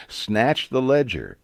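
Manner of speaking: usual speaking voice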